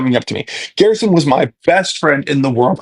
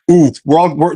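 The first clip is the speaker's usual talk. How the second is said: normal talking voice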